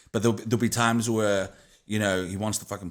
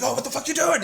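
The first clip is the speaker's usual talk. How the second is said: much higher, louder mock outraged yell; imitating angry brother; 5 dB louder than usual. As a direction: play the furious big brother, squeaky. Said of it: "he'd get even more angry".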